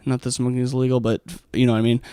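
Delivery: ordinary talk, nothing marked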